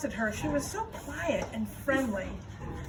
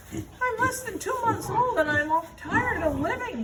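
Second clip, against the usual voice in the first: higher, whiny little pig; a bored piglet complaining; young pig voice; 8 semitones higher than usual. Acted. Wilbur's voice in story.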